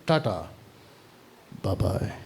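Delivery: low, soft, offhand goodbye; casual mock farewell. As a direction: let them go with a quiet pretend send-off. Acting out Jesus dismissing deserters.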